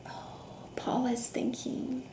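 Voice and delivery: very soft whisper. Acted. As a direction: whisper so she can think. Whispering while Paula thinks.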